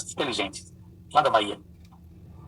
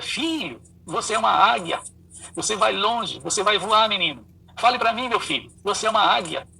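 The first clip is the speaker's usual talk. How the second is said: proud father cheering; 8 semitones higher, 3 dB louder than usual. Retold or imitated. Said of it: "o pai dele dizia".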